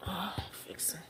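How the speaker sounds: real whisper while fixing things. Real, not acted. Vlogger whispering to herself while fixing the camera. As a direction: mutter it under your breath, voiceless.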